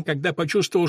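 ordinary delivery

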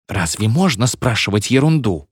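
ordinary delivery, nothing marked

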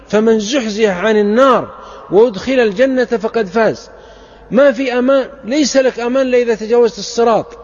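fervent preacher pleading with listeners; impassioned preaching. Acted. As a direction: press the warning home to the crowd. Preacher warns the congregation.

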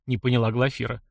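ordinary delivery, nothing marked

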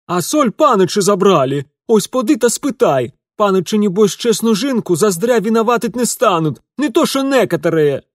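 high, loud indignant cook; shrill indignant woman. Acted.

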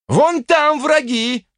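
high, drawn-out cry; pointing shout. A narrator's reading. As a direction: call it out high to direct fighters. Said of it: "сообщил я". (указующий крик)

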